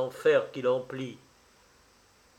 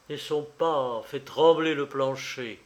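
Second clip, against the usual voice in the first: ordinary delivery, nothing marked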